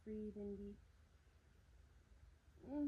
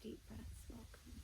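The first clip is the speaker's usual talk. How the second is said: whispered, softer invitation; gentle whispered welcome; 8 dB quieter than usual. Acted. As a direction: breathe the words out in a whisper. Invites sighs during yoga.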